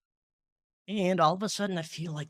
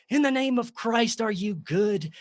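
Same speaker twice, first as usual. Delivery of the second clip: higher, louder invocation of Christ; mock religious challenge; 8 semitones higher, 5 dB louder than usual. Man retelling his panicked challenge to a voice, mock religious invocation. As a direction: challenge the spirit in raised, hammed-up piety. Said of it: "reverted to some childhood dogma".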